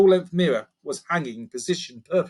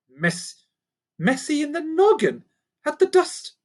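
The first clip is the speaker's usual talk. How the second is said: much higher, puzzled repeat; confused. Acted. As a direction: echo the odd phrase in disbelief.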